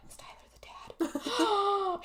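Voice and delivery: high, slow, breathless whisper with a laugh; whispered suspicion. Retold or imitated. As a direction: whisper it like a juicy secret. Wondering aloud while watching.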